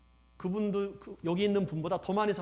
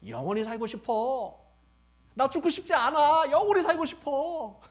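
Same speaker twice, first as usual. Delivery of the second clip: put-on old man longing to live; 9 semitones higher, 8 dB louder than usual. Preacher voices a very old man clinging to life, hammed-up plea. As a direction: cry out a wish to live, hammed-up. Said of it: "영혼이 있으니까 뭐라 그래?".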